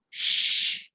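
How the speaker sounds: sh as an unvoiced hush; just the sh hush; sh sound. Shown as a demonstration. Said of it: "các âm như là ch".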